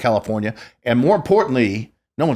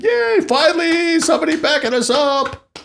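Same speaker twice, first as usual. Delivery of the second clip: put-on cheering older inmates. Older inmates cheer on their champion, hammed-up jubilation. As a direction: imitate a crowd's squealed hurrah, far above your usual pitch. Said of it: "the older guys were like".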